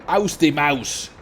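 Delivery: put-on angry German, drawn out slowly; mock angry German. Gamer showing how angry German has to be, mock angry. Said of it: "Did I say it angry enough?".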